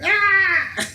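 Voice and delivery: high wailing baby cry, mock; imitated baby cry. Baby woken in the back room, imitated high wail. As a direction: burst into a shrill infant wail.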